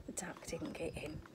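soft whisper of relief; hushed comfort; reassuring whisper. Real, not acted. Reassuring aside to child.

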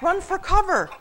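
high, loud urgent cry; loud high alarm; yelled warning. A narrator's reading. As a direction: shout the warning on the run. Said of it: "yelled Soldier".

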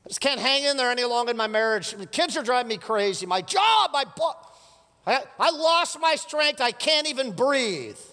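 high, strained, worn-out wail, slowing down; mock despair, slowing and breaking; exhausted complaint. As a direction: plead like someone at the end of their rope. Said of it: "You ever felt like that?".

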